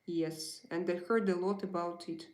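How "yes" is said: usual speaking voice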